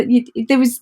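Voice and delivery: ordinary talk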